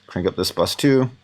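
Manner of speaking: usual speaking voice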